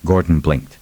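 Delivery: ordinary delivery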